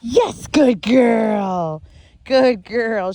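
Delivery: very slow drawn-out praise. Real, not acted. Trainer rewarding dog for heeling, very slow drawn-out praise. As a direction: stretch each word to reward her. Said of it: "I'm gonna praise her".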